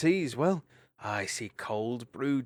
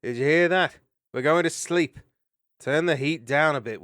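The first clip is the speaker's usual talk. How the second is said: calling out to the sun; 5 semitones higher, 8 dB louder than usual. Acted. Dandin calls out to the sun.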